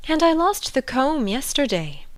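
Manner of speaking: high, plaintive voice after a sigh; sigh, then lament as a helpless queen; sighing high-pitched royal. A narrator's reading. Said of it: "said with a sigh".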